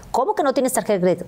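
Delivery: high, quick astonished question; incredulous boyfriend. Retold or imitated. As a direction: imitate someone who can't believe it. (asombro imitado, agudo y rápido)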